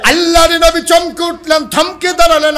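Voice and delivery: loud, high-pitched preacher's cry; high, ringing sermon shout; full-force preaching. A narrator's reading. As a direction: belt out the narration at the top of the voice.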